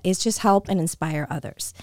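ordinary talk

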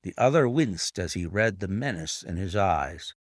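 usual speaking voice

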